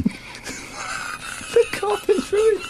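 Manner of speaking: fail to get the words out for laughing; laughing at the ruined carpet; laughing, trailing. Real, not acted. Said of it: "Stop it".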